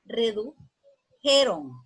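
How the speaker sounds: "redujeron" lento, partido en "redu... jeron"; forma correcta silabeada. Shown as a demonstration. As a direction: separa "redu" de "jeron" con una pausa. ("redujeron" pausado, como dictado de maestra)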